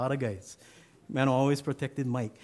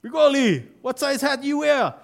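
very high, loud drill call; a sergeant calling out names to the recruits; sergeant shouting; 12 semitones higher, 8 dB louder than usual. Retold or imitated. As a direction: call the roll at full volume. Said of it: "the clothing sergeant calls out again".

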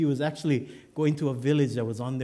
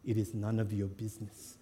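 low, soft, measured refusal; icy stranger shutting down questions; stone cold; 3 semitones lower, 6 dB quieter than usual. Acted. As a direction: repeat it coldly, dropping quieter. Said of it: "the guy looks ahead and he says".

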